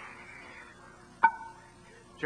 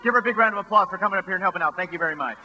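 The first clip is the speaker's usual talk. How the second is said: showman presentation; 2 semitones higher than usual. Acted. Sideshow emcee presenting stunt.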